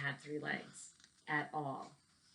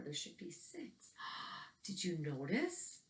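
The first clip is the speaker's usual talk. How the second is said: hushed, breathy whisper; secret whisper; 7 dB quieter than usual. Acted. Teacher whispering the puzzle answer to children, hushed.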